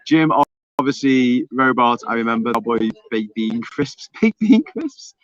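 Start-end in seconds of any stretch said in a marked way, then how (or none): 4.2-5.2 high, slow, laughing query; amused disbelief. Real, not acted. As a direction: repeat it as an incredulous, amused question. Reads an odd crisp flavour.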